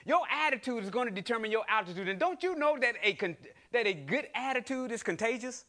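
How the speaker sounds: ordinary talk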